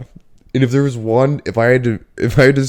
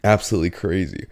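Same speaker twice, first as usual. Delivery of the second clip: ordinary talk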